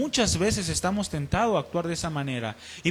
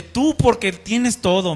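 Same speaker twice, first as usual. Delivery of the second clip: reproche; 4 semitones higher, 6 dB louder, slower than usual. Retold or imitated. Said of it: "Me dice".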